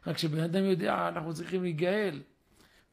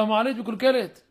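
louder, pressing announcement about the elevator; insistent complaint; 5 semitones higher, 10 dB louder than usual. Retold or imitated. Son at the door demands the elevator fixed, loud and insistent. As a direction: say "המעלית מקולקלת" loud and demanding, a push.

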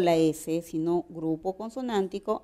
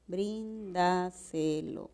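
"bríndaselo" slowly split as brín-da-se-lo; measured, drawn-out syllable demo of "bríndaselo"; 6 dB quieter, much slower than usual. Shown as a demonstration. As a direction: softly separate each syllable of "bríndaselo". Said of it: "la base es un buen silabeo".

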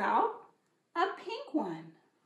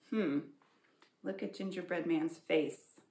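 ordinary delivery

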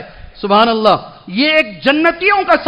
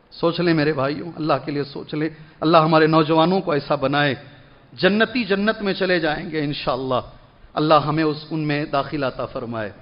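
low, soft prayer voice; gentle supplication; 8 semitones lower, 8 dB quieter than usual. Real, not acted. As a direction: drop into a hushed blessing for the congregation.

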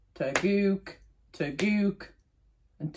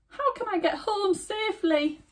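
put-on worried old woman.

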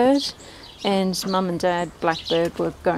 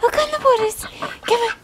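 very high sing-song cheer; high pet voice; 4 dB louder than usual. Real, not acted. Cheering her duck onto the water, high sing-song.

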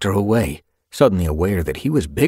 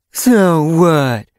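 loud mocking echo in another voice; sneering; 10 semitones higher, 7 dB louder than usual. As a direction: sneer it back at him. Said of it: "echoed with a sneer".

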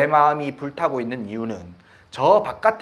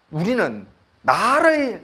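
ordinary delivery

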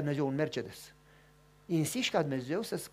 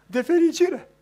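high, tearful happy voice; a woman weeping with joy; lightly mimicked tearful joy; crying woman; 11 semitones higher, 11 dB louder, slower than usual. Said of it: "și plângea".

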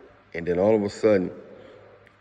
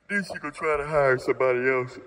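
high-pitched warning; 6 semitones higher than usual. Real, not acted. Coach warning men she'll hire somebody else, high-pitched. Warns what the wife would do.

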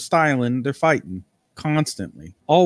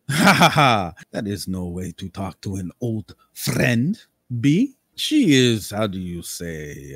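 eccentric nobleman =1; slower than usual. Acted. Lord Cambria answers B.

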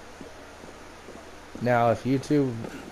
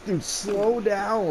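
drawn-out, higher imitation of a friend; friend's plea; 7 semitones higher, much slower than usual. Friend lagging behind in the game, drawn-out mock plea. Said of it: "He's like".

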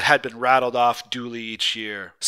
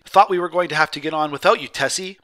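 quick, cheerful and louder; 5 semitones higher, 6 dB louder than usual. A narrator's reading. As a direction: tease her cheerfully for being late. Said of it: "said cheerfully".

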